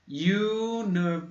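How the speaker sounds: University starting high, on a yu sound; English university. Shown as a demonstration. Teacher modeling university's opening yu. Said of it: "mas tem som de I".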